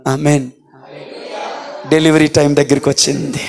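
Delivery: high, ringing sermon climax building on each line; rising revival preacher's call; full-force preaching. Acted. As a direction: preach it up to a sung peak.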